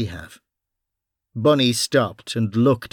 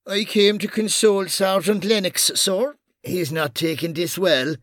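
raised, falsely solemn explanation; Irish detective voice; 8 semitones higher than usual. Acted. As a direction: play innocent for the boss. Bunny fake solemn.

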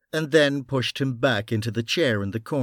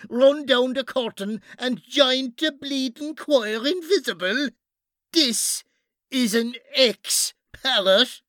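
very high, drawn-out comic character voice; hammed-up comedy routine; 13 semitones higher than usual. Bunny blocking Mara with a comedy routine over the body, theatrically insistent. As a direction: declaim it high and slow, hamming it up. Bunny recites dead parrot lines.

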